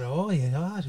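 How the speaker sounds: a quiet, sing-song Swedish "Oh, yeah"; Swedish lilt "oh yeah". Retold or imitated. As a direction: imitate a Swedish speaker's musical lilt, softly. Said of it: "a Swedish person talking that".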